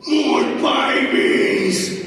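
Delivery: high, loud, very slow creature roar; creature shout. Acted. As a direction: drag it out as a monster roar. Kangaroo roars for more.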